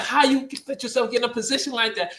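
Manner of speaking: high, fast scolding question; exasperated demand, rattled off; retold anger. Housing worker questions a pregnant teen, fast exasperated scolding. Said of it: "I'm so mad with you".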